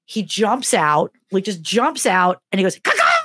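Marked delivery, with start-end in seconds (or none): 2.8-3.1 very high, loud "Caw-caw!" screech; a friend leaping out screeching "Caw-caw!"; screamed bird call. Retold or imitated. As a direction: shriek it like a jump scare. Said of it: "he just screams it".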